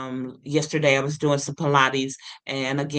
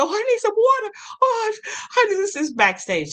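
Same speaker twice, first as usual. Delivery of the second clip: squeaky, much higher desperate plea; high pleading voice; 3 dB louder, faster than usual. Retold or imitated. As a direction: beg in a high, thin voice. Pleading backstage for water.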